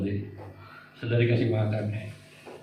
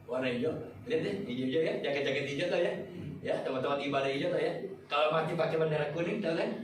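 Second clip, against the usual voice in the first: much softer, half-laughing; quiet joking aside; 3 semitones lower, 9 dB quieter, faster than usual. Real, not acted. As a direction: share the joke under your breath. (bercanda pelan kepada jemaat sambil tertawa kecil)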